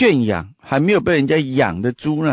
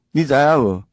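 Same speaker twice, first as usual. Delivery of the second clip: ordinary talk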